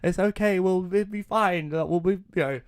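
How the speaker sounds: high, loud soothing mother voice; mock mum reassurance. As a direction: play the mum calming her son in mock panic. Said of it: "And she's like".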